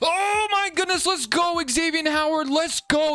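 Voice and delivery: high, thrilled celebration; elated cheer. Real, not acted. Gamer celebrating Xavier Howard's game-winning pick, elated.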